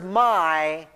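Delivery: "my" stretched out and held alone; emphasized word. Shown as a demonstration. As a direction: set "my" apart as a weighty word. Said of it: "notice the man's emphasis on the words".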